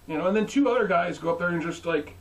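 ordinary talk, nothing marked

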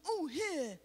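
pushed out. Shown as a demonstration. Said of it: "rather than just".

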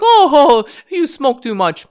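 high, sneering mock voice; mocking smug scold. Mocking a pizza-eating scold who sneers at smokers, sneering. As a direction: make fun of a preachy non-smoker. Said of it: "and you're like".

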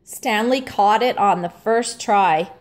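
ordinary delivery, nothing marked

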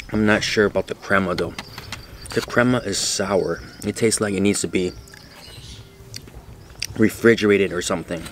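chewing while talking; speaking between bites; mouth full of food. Real, not acted. Seen 3: Bites from fork at +5.3; chews between phrases throughout.

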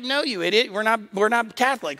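high, exasperated mother voice; mock scolding. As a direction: imitate a mom setting her silly kid straight. Mother scolds him in retold scene.

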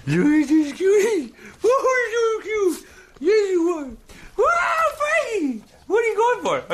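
very high, drawn-out squeals to a dog; real excited cooing at a pet; excited pet talk. Real, not acted. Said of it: "I'm a little too excited".

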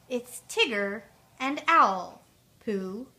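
slow, spelled-out recollection; drawn-out, loud first words, as if working it out; slow repeating. Retold or imitated. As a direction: piece the words together deliberately. Pooh slowly repeats what Owl said.

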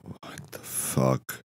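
slow, breathless whisper; slow stunned whisper; whispered curse. Retold or imitated. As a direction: mutter it in a hushed whisper to the mirror. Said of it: "And you're like".

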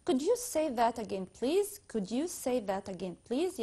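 slow, clearly spaced English request; slow demo. Shown as a demonstration. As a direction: model the polite request slowly. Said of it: "أقول في الأول". (طلب مهذب ببطء)

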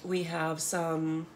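usual speaking voice

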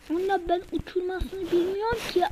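ordinary delivery

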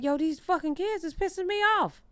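lightly voiced high complaint; mock grumble.

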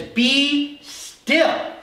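booming acted rebuke; commanding rebuke. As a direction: order the storm down, each word set apart. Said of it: "he rebukes the wind and the waves".

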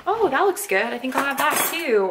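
high, bright "oh, that looks good"; excited inner voice. Retold or imitated. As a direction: gush over the buffet food. Voicing herself at the buffet.

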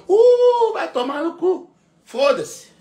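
high, loud mock yell from the stands; hammed-up crowd heckle; mock heckler yell. Voicing a heckling crowd, yelling.

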